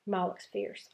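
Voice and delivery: plain reading voice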